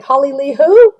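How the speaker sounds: high, loud, brushing off a forgotten name; mock dismissive. Job seekers who landed jobs forgetting their coach, mock dismissive. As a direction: act like you've never heard of her.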